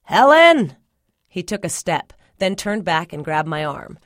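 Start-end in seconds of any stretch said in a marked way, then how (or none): 0.0-0.8 loud, high yell toward the house. A narrator's reading. Daddy whirls and calls Mama from outside, yelling. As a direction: holler her name at full voice. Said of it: "You don't have to yell".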